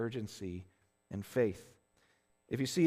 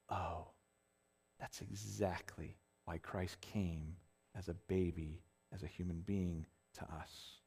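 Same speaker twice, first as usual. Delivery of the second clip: low, soft and slowing; much softer and lower, measured, with pauses; hushed realization; 7 semitones lower, 6 dB quieter than usual. Retold or imitated. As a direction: let it dawn on you, quietly and reverently. Hushed realization closing the story.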